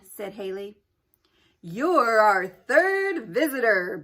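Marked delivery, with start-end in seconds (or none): 1.6-4.0 slow, loud booming game-show host; jolly announcer. A narrator's reading. Ceiling announcer tells Haley she won, jolly and loud. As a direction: boom it like a cheerful prize announcer. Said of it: "boomed the jolly announcer in the ceiling".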